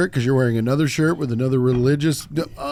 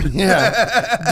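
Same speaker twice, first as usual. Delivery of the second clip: ordinary talk, nothing marked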